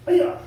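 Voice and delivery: piercing high yell on the technique; kiai shout. Shown as a demonstration. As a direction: snap out the yell with the move. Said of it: "number one is like this".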